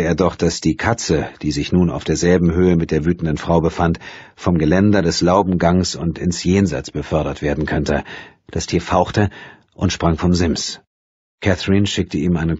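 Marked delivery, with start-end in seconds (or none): none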